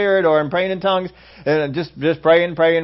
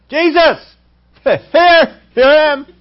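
loud, high hollered cry to Jesus; hollering; 12 semitones higher, 9 dB louder, much slower than usual. Retold or imitated. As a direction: shout it out like an eager believer. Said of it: "some of them are hollering, screaming, shouting".

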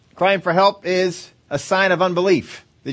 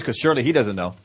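normal talking voice

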